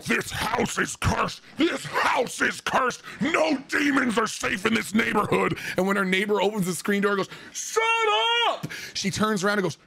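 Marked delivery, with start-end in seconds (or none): 0.1-5.6 mock screaming. Woman screaming in the middle of the road, mock screaming. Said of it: "screaming is going". 7.5-8.7 stretched-out mock holler; neighbor's yell. Neighbor yelling at the woman from the screen door, mock yell.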